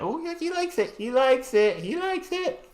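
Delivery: put-on very high squeaky baby talk. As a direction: coo it like to a pet. Baby voice for the pet.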